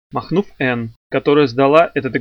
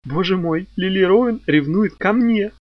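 higher voice, drawn out in wonder; amused amazement; 6 semitones higher, slower than usual. A narrator's reading. Ann tells Archie that Lili is jealous, amused amazement. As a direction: marvel at it, stretching the words slowly upward.

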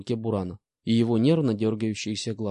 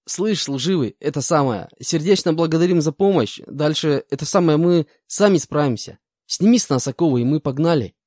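swaggering convict voice; 5 semitones higher, 6 dB louder than usual. Acted. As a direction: talk down to the soldier like a career crook. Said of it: "обратился ко мне мужчина".

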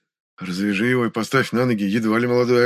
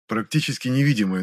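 ordinary delivery, nothing marked

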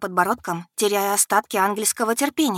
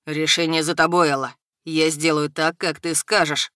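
low menacing dragon; 3 semitones lower than usual. A narrator's reading.

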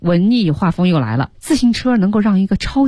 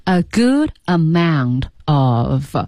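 slow, lower teaching repeat of A GOOD AMOUNT OF; slow dictation; 4 semitones lower, much slower than usual. Shown as a demonstration. Teacher giving a phrase to memorise, slow and deliberate. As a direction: draw out A GOOD AMOUNT OF word by word. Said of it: "注意这个量词必须记下来".

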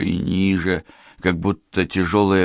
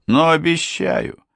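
slow, raised solemn promise; 11 semitones higher than usual. A narrator's reading. Unwelcome guest gives his promise, slowly and solemnly. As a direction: draw the promise out grandly. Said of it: "торжественно проговорил незваный гость".

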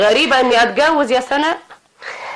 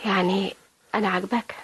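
coy whisper; 4 semitones lower, 10 dB quieter than usual. Acted. Wife flirts with new husband.

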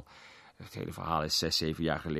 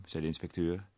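usual speaking voice

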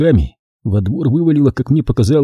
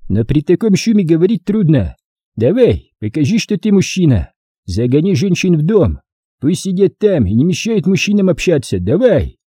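lightly voiced commander, pitched up; командир отряда; 6 semitones higher than usual. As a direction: urge a youth to take charge. Figure of the story. (командирский голос, с нажимом)

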